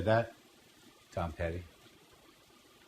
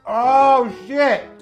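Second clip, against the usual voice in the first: high, loud startled "oh shit"; surprised shout; 14 semitones higher, 10 dB louder than usual. Real, not acted. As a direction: blurt it out, shocked. Cuts into the steak.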